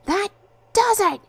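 very high, loud furious outburst; enraged shout in two bursts; angry bellow. A narrator's reading. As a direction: bellow it, voice shooting up. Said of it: "he bellowed angrily".

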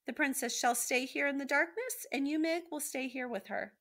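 high, loud pronouncement, easing at the end; villain's high-pitched decree; defiant rat. A narrator's reading. Rat defiantly pronounces their fate.

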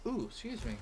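hiccuping mid-sentence; hiccups. Real, not acted. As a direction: talk through hiccups. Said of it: "now I got the hiccups".

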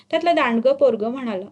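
ordinary delivery

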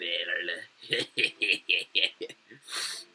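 low, hammed-up villain cackle; playful mock evil cackle; mock evil laugh. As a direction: laugh like a cartoon villain plotting. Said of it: "I will do something evil".

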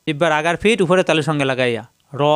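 ordinary talk

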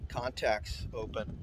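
ordinary talk, nothing marked

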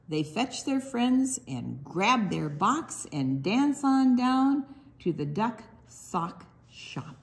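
sing-song picture book read; reading to children. Acted. Librarian reads duck book to children, sing-song. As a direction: bounce the rhymes for little listeners.

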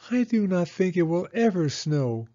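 put-on high child's voice, a touch downcast; a small girl giving up hope; little girl Penny. Penny telling Rose it won't snow, high childlike voice.

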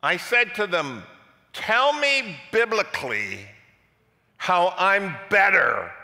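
loud, high climactic sermon delivery; full-force preaching. Acted. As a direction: build it up and thunder to the back row.

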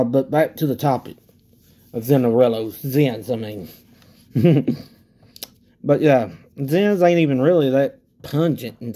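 with a dip in; tobacco in lip. Real, not acted. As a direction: lip pouch, spitting into bottle. Seen 3: Puffed lip throughout; spits into a dark bottle at 8.7-11.7s.

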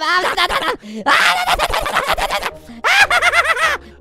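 high, loud "na-na-na" chomping noises; frenzied gobbling. Pretend. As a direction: devour prey like a cartoon monster. Spider player pretends to eat prey.

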